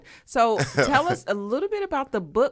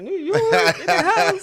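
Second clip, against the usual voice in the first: laughing, drawn-out cheer, pitched way up and louder; hometown cheer; 12 semitones higher, 7 dB louder, slower than usual. Real, not acted. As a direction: hype up New York with a laugh.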